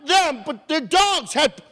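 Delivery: high, lightly voiced neighbor; a hint of a high neighbor; neighbor's voice. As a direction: pitch it up like the neighbor. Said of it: "They said".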